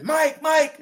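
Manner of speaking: imitating wife's high, loud call; calling out urgently. Wife calling from the door, retold.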